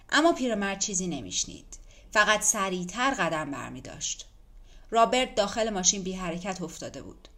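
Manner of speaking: usual speaking voice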